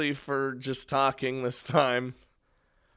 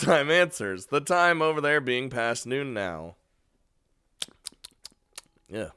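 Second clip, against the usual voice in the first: usual speaking voice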